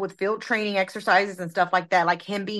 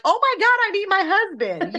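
mock panicked wail; 12 semitones higher than usual. Wife recalling needing her absent husband, mock panicked wail. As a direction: play up the helpless panic. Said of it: "but I'm thinking like".

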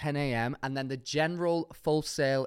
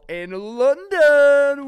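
excited announcement; 13 semitones higher, 10 dB louder, much slower than usual. Real, not acted. Announcing biggest show.

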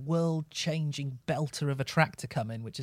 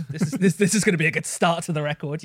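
louder, stammering excitement; loud mock hype; 3 semitones higher, 8 dB louder, faster than usual. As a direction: sell it like a hyped-up fan, fast.

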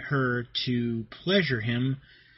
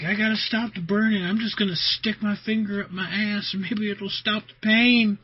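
mock desperate voice; 7 semitones higher than usual. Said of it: "It's like".

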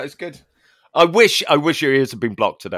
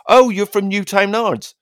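higher-pitched mock reaction to Newtownards; imitating outsiders; 8 semitones higher than usual. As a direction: voice other people naming the town. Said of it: "People go".

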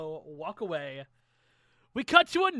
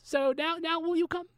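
bard asks; 9 semitones higher than usual. Acted. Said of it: "He goes".

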